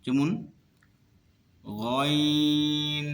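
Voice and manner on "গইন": গইন with light friction at the back of the throat, long-held; lightly rasped ghayn. Shown as a demonstration. Teacher modelling the letter গইন, light friction, long-held. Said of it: "এখানে হালকা ঘর্ষণ খাবে".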